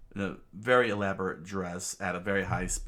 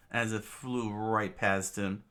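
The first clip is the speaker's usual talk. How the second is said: ordinary delivery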